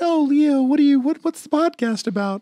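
put-on high, airy girly quoting voice. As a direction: play the chirpy girlfriend asking. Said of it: "the girlfriends were like".

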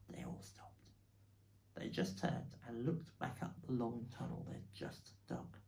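usual speaking voice

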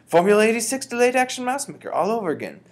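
very high, loud exclamation; high-pitched triumphant shout. A narrator's reading. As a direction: proclaim it at the top of your voice. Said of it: "my grandmother shouted".

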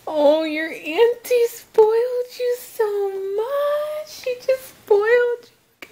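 high, slowed cooing delight; real high-pitched doting gush. Real, not acted. Gushing to her little girl.

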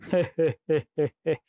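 string of quick he-he giggles; comic, non-scary demon giggle; ticklish laugh. A narrator's reading. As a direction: giggle helplessly like a tickled monster. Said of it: "भयानक ढंग से नहीं हंस रहा".

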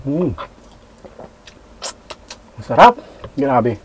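talking while chewing; chewing food. Real, not acted. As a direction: mouth partly full. Seen 1: Bites food at -5, then chews; eats again at 1.5s while speaking.

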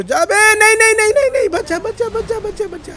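high yelps fading in volume; high-pitched urgent panic; panicked cry. Real, not acted. Panic as vehicle is about to fall.

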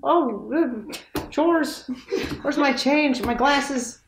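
fussing mom looking for her stuff; imitating his mom. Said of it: "just read my impression of my mom".

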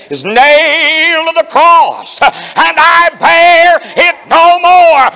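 preacher carried away by the hymn; hymn recited with building fervor. Acted. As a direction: build the hymn verse to a rapturous peak.

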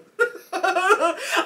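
high bursting laugh; burst of laughter. Real, not acted. Laughing at her own panic to the dentist. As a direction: laugh out loud at yourself.

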